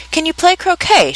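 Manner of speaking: high, half-shouted question. A narrator's reading. The Queen shouting a question at Alice, mildly shouted. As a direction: call it out imperiously high, little extra volume. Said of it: "shouted the Queen".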